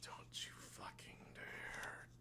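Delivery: barely voiced, slow whispered mutter; muttering under breath. Captain on deck watching a ship, muttering under his breath. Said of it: "he's muttering under his breath".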